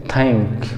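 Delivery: Indian-style THANK, tongue-stop t-h; local Indian pronunciation. Shown as a demonstration. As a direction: THANK with a hard aspirated t. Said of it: "जैसे हम लोग बोलते हैं".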